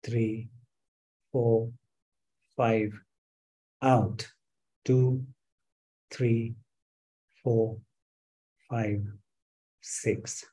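soft, slow guided count for a breathing exercise; calm instructor counting a breathing exercise; counting breaths. Shown as a demonstration. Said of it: "now you can breathe normally".

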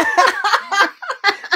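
high, loud laughing fit; real burst of laughter. Real, not acted. Host amused by the winner's name mix-up: loud laughter.

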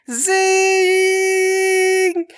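long, high sustained ring; ringing-ears noise. A narrator's reading. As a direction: hold a piercing tinnitus whine. Imitates ringing ears after flashbang.

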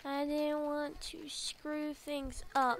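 soft, hesitant admission; subdued confession. Acted. Friend admitting fear of ruining things, softly subdued. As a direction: say it quietly, almost to yourself. Quieter second reading of game line.

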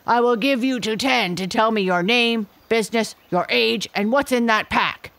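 bully him into answering; gorilla threatening. A narrator's reading. Gorilla threatens my father, loud and bossy.

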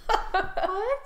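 high shocked laughing what; surprised. Retold or imitated. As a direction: squeal it in disbelief. Disbelief at Toy Story.

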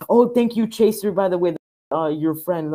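high, loud burst of gratitude; excited streamer shout-out, pitched high; excited thanks. Real, not acted. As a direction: thank a new follower in delighted surprise. Thanking a new follower.

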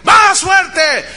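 high, very loud command; motivator. Retold or imitated. Coach still unsatisfied with staff, motivator bellow. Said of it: "empecé a escuchar al motivador".

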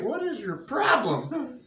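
a hint of a fed-up father; exasperated God. Preacher voicing God fed up with him, slow mock exasperation.

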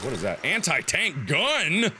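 ordinary talk

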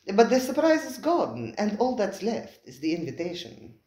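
lightly voiced, suave host; smooth restaurateur Spiro. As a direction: slide in smoothly, amused.

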